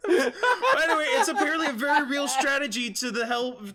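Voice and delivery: high, loud, struggling past giggles; talking through laughter. Real, not acted. Reader explaining the real strategy, talking through laughter. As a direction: push on while still laughing.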